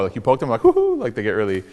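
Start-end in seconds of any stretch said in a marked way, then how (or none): 0.6-1.0 very high-pitched giggly hoot; a squeaky little "woohoo" when poked; Pillsbury Doughboy. Retold or imitated. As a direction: imitate a poked cartoon mascot, much higher. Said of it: "They're like Pillsbury Doughboy".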